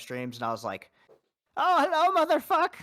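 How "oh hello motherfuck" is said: high, loud put-on character voice; cartoonish high greeting. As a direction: pitch way up into the silly character voice. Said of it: "doing the voice all the time".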